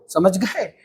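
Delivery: ordinary talk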